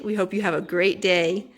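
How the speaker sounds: ordinary delivery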